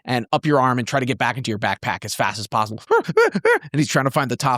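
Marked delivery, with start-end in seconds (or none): 2.9-3.7 short, very high panicky squeaks; frightened creature squeals. Acted. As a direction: squeal like a tiny scared critter. Voices frightened stone creature.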